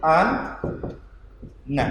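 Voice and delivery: An, na: closed an, then na; split into two syllables. Shown as a demonstration. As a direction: separate An from na. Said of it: "dua nun".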